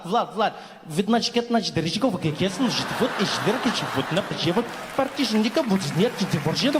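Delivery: Russian-accented phone chatter into fake Russian; mock Russian. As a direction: scratch English like a DJ in a Russian accent. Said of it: "sounds like a D J took a turntable".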